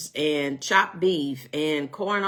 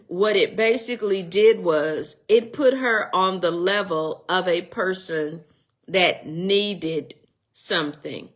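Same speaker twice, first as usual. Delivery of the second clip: ordinary talk, nothing marked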